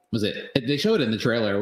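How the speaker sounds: ordinary talk, nothing marked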